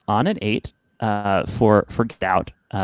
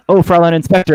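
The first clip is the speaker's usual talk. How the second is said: higher, louder guard's exclamation; startled guard; 7 semitones higher, 6 dB louder, faster than usual. Acted. Game master voicing a guard to the inspector, exclaiming. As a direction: call out as the guard. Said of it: "you see the guard saying".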